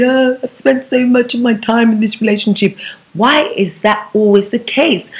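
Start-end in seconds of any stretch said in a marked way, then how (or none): 0.0-2.8 imitated self-pitying thought with a slight whine; lightly put-on woeful voice; mock lament. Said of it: "then you are there thinking".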